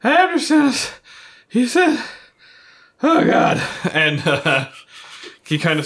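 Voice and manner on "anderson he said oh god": high, loud frantic cry; panicked character. Acted. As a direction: blurt it out in a panic.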